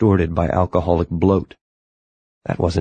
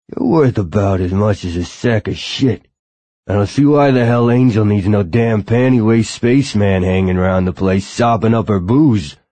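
contemptuous, louder bouncer voice; angry gruff bouncer; 3 semitones higher, 3 dB louder than usual. A narrator's reading. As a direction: sneer it at the spaceman, louder. Bouncer insulting Tachyon.

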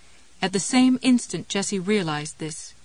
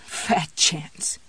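low, croaky near-whisper; croaky, wry; 4 semitones lower than usual. A narrator's reading. Jessie scoffing to the empty room, croaky. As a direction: croak it wryly to yourself. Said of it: "voiced a croaky little laugh".

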